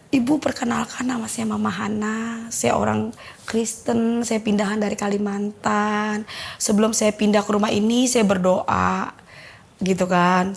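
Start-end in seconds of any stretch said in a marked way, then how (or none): 0.1-9.1 slow, measured, phrase by phrase; halting speech. Retold or imitated. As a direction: imitate a halting self-introduction, stretching each phrase. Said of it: "agak tertatah-tatah". (menirukan bicara tertatah-tatah, lambat)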